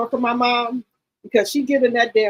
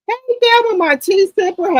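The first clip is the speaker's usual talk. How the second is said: excited greeting; 11 semitones higher, 3 dB louder than usual. Real, not acted. Vlogger greeting a regular viewer in a high excited voice. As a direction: call out to a viewer gladly. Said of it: "greeting a chat viewer".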